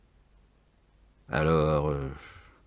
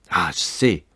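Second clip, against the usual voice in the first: ordinary talk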